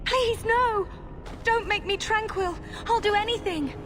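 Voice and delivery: sobbing, high-pitched begging; terrified crying plea. Acted. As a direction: beg through tears in terror. Girl begs not to be made tranquil.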